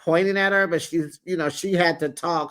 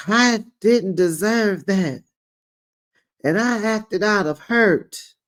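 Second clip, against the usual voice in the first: mock crying; 6 semitones higher than usual. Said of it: "Then Simone crying".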